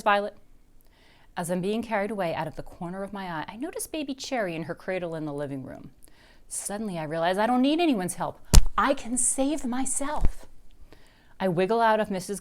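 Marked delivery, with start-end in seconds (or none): none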